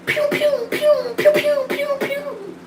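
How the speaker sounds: squeaky high laser pew sounds; high pew gun noises. Pretend. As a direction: pew pew like a toy blaster.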